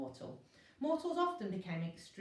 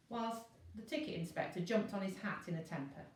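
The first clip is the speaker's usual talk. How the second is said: ordinary delivery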